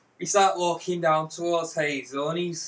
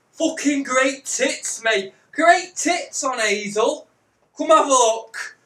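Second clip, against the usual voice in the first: high, relishing the words; eager sales pitch; 6 semitones higher, 5 dB louder, slower than usual. Retold or imitated. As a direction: talk it up, then loudly beckon him over. Retold hard sell to the stranger.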